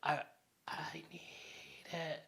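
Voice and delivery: barely audible, mock-desperate whisper; craving whisper. Acting out giving in to the offered substance, craving whisper.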